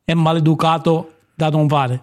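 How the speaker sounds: usual speaking voice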